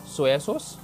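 usual speaking voice

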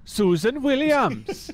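mock Welsh accent. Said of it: "with a Welsh accent?".

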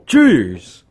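usual speaking voice

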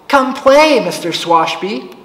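put-on high, bright child calling out; calling girl. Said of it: "the girl called back".

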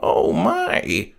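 put-on high, soft croaky old woman; a frail old lady croaking out a surprised oh my. A narrator's reading. Frail old lady thanked after nearly being knocked down, soft high croak. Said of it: "she croaked".

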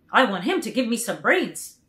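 eager straw man who wants it badly; eager Scarecrow. A narrator's reading. Scarecrow telling the man his wish, eagerly. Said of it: "said the Scarecrow eagerly".

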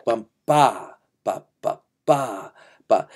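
short bum, then a long, stressed BA; accented syncopation. Shown as a demonstration. Professor correcting a mistake by demonstrating, syncopation chanted with accents. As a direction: punch the long off-beat BA in each group. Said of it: "we play it with the accent on and of one".

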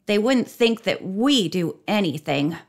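ordinary delivery

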